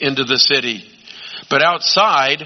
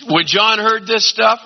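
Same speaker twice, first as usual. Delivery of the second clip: normal talking voice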